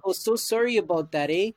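put-on a light jokey Canadian accent saying sorry; mock Canadian apology. Joking about Canadian politeness, hammed-up Canadian accent. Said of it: "'Cause that's the Canadian spirit".

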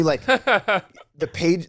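drawn-out words through laughter; laughing. Real, not acted. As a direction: laugh while slowly stretching it. Laughing over his own story.

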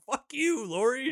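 squeaky high cartoonish taunt; high mocking squeal. Mocking Michael taunting Lori, high squeaky voice.

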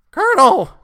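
very high, loud shrill cry; shrill call. A narrator's reading. Boy calls to the colonel, very high and loud. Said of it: "Benjamin called shrilly".

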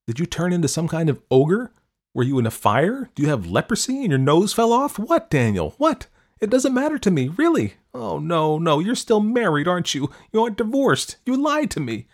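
loud, high, accusing outburst; angry and hurt. Acted. Dorothy shouting at Daniel over text, angry and hurt. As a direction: hurl the questions at him, rising in fury. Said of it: "She was angry and hurt".

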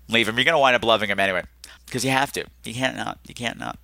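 usual speaking voice

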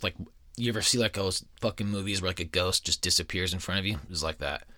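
ordinary talk

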